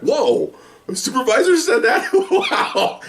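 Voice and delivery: high, laughing disbelief; amazed reaction. Retold or imitated. Officer hearing his supervisor swear, comically amazed and high-pitched. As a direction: act out astonishment in a rising falsetto.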